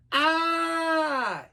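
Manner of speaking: long, high falling scream; high drawn-out "Ah"; falling cry. Acted. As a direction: cry out while tumbling down. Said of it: "he cried as he hurled".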